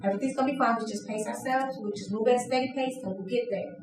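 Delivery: soft, calm reassurance; soothing dove. Acted. Trainer voicing the dove to the flock, softly reassuring. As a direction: settle everyone down gently. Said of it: "Then you have the dove".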